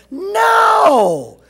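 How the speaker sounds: high, loud, drawn-out refusal; defiant shout. A narrator's reading. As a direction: bellow it in a heated argument. Father argues with cashier.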